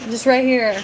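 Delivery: normal talking voice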